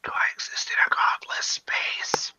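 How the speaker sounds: soft mock-forlorn whisper. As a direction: whisper into the void. Joking whisper while unheard.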